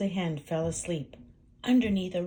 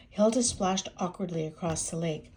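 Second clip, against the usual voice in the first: usual speaking voice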